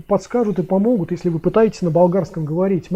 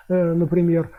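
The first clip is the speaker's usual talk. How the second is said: ordinary talk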